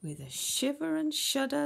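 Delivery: ordinary delivery, nothing marked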